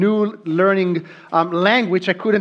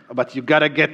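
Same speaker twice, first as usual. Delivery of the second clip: ordinary delivery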